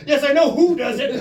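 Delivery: high, loud retold retort; mock exasperation. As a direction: snap back in comic frustration. Acted exasperated reply.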